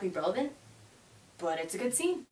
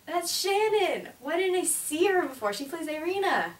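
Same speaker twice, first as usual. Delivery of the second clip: high, loud excited recognition; thrilled fan spotting an actress; excited squeal; 8 semitones higher, 4 dB louder than usual. Retold or imitated. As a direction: gasp out the name in delight. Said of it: "I was like".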